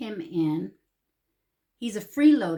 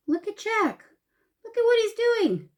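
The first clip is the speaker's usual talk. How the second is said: high-pitched, delighted pointing out; excited husband; 12 semitones higher, 4 dB louder than usual. Retold or imitated. Husband Rich pointing out the cat at the window, high and excited. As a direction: lift it high and amused, calling attention. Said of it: "Rich says".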